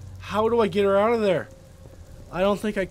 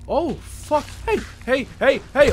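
higher-pitched, alarmed exclamation; startled; 6 semitones higher than usual. Real, not acted. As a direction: yelp repeated warnings as pests jump at you. Startled by attacking rad roaches.

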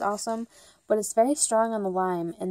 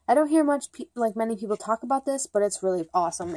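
rushed, fast chatter; talking too fast; faster than usual. Real, not acted. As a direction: rattle it off quickly. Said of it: "I'm, like, talking way too fast".

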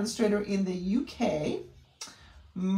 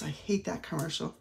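ordinary talk

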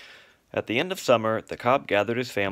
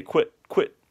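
higher, clipped bird call; partridge call; 6 semitones higher, 4 dB louder than usual. Retold or imitated. Father imitating the partridge call for the cygnets. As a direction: chirp it out like a partridge. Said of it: "heard the partridge say".

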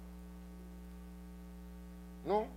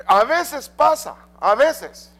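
high, loud, drawn-out a veces pasa; emphatic; 11 semitones higher, 8 dB louder, much slower than usual. Acted. Preacher to congregation, first a veces pasa called out high and loud. Preacher repeats phrase, contrasting takes.

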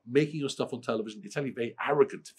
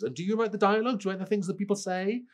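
much higher, wide-eyed mock-innocent asker; mocking a naive questioner; 11 semitones higher, 4 dB louder than usual. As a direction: ham up the clueless fan's question.

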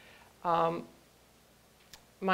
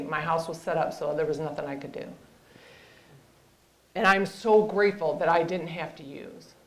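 usual speaking voice

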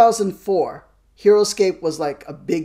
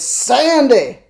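excited call; 6 semitones higher, 8 dB louder than usual. Real, not acted. Greeting wife joining the stream.

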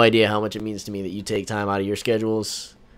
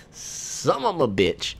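rueful curse; 5 semitones higher, much slower than usual. Real, not acted. Curses after being killed.

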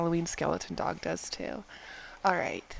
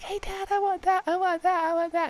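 put-on higher childlike whining to dad; little girl begging; 10 semitones higher, 4 dB louder than usual. Girl begging her dad for a drink, childishly. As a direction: beg like a small kid. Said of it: "So I was like".

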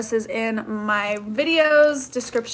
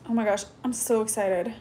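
ordinary talk

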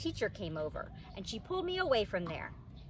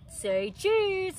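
cheese man; 10 semitones higher, 4 dB louder than usual. A narrator's reading. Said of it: "he told me".

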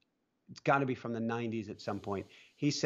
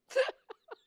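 high laugh. Real, not acted. Laughing at own joke.

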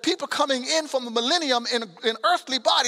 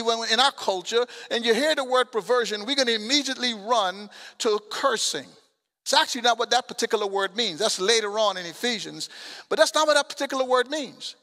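normal talking voice

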